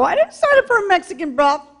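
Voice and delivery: shocked protest. Retold or imitated. Shocked reply in brothel.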